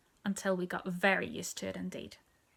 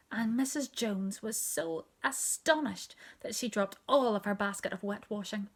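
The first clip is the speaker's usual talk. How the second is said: plain reading voice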